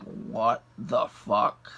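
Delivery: very slow, low retold disbelief; flabbergasted. Driver hearing the robbery accusation, flabbergasted. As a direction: drag each word out, dumbfounded. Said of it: "just flabbergasted".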